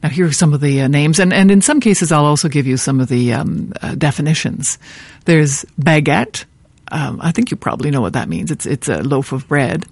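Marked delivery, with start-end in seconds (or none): none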